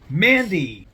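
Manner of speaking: loud, high announcement of the name; proud little fanfare on the name; excited reveal. Real, not acted. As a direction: call out the winner like a game show result. Announcing the die roll result.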